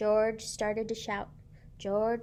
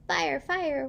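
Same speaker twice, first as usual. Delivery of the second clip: rescue call; 6 semitones higher, 5 dB louder than usual. Acted. Luther plays rescue hero.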